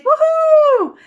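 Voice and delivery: very high, loud whoop; cheer. Acted. Students cheering the headmaster, high loud whoop.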